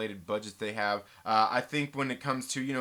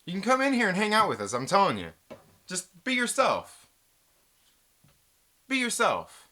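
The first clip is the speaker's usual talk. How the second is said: insisting; 5 semitones higher, 6 dB louder than usual. Real, not acted. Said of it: "louder insistence".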